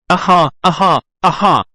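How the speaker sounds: a ventriloquist's menacing cackle; terrible laugh. Acted. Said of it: "Oh, the ventriloquist's terrible voice".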